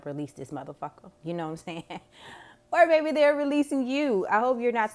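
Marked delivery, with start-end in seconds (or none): 0.0-1.1 soft, quick conspiratorial aside; muttered aside. Pretend. Quoting advice under breath. 2.7-4.9 high, loud playful exclaim; teasing excitement. Real, not acted. Teasing twist after a laugh.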